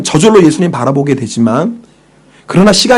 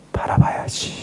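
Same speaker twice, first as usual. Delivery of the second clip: whispered resolve; 12 dB quieter, slower than usual. Pretend. Preacher voicing a believer resolving to look to Jesus, whispered. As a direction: breathe 바라봐야지 almost silently to yourself.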